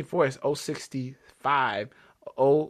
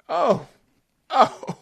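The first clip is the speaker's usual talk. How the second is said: excited; 9 semitones higher, 6 dB louder, much slower than usual. Real, not acted. Amazed at record speed run.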